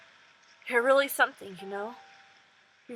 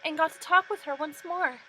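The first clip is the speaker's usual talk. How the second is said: put-on higher, bright young girl saying goodbye; grateful farewell; 7 semitones higher, 4 dB louder than usual. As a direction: thank her warmly, letting go. Voices Yuzu's grateful farewell.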